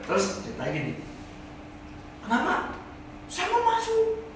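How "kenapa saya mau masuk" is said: pleading newcomer. Acted. Man at heaven's gate pleads.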